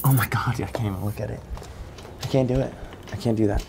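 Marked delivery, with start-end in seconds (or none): 0.0-1.4 whispered under the breath in fear; scared whisper. Real, not acted. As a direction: whisper it, frozen.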